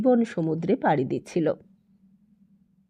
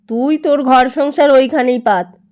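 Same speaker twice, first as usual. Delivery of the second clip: a lightly voiced elderly uncle, louder; old uncle speaking; 4 semitones higher, 6 dB louder than usual. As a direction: speak up as the old uncle sending him off to his own house. Uncle tells Prakash to move out. (বুড়ো পিসেমশাইয়ের হালকা গলা, একটু জোরে)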